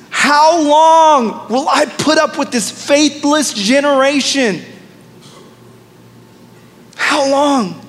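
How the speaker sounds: a touch of weary divine anger; frustrated God. Acted. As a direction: let God's frustration swell, slowly. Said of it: "God is frustrated".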